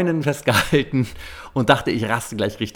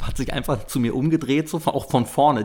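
ordinary talk

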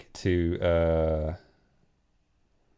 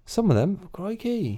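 gawp in the put-on accent; mock cockney; 9 semitones higher than usual. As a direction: player mocking the peddler's boast. Player keeps put-on voice.

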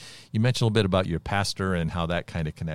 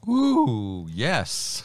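impressed ooh; 5 semitones higher than usual. Real, not acted. Host reacting to the line.

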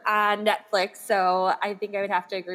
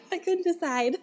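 laughing; 9 semitones higher than usual. Real, not acted. Repeats it while laughing.

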